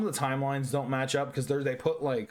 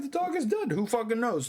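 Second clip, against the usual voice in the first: squeaky pitch jump; 10 semitones higher than usual. Real, not acted. Schrodinger joke, pitch jump.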